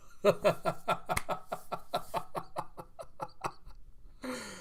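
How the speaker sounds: high, breathless staccato laugh trailing on; drawn-out mocking laughter; long staged cackle.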